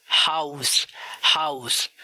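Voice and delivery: हाउस with a clear, audible h; हाउस, never with a silent h; voiced h. Shown as a demonstration. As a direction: breathe out the h in हाउस. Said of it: "हा आमतौर पर silent नहीं होता".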